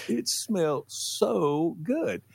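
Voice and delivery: high, very slow savouring; lightly voiced child defending the smell; dreamy drawn-out kid. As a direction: stretch it out lovingly like a kid.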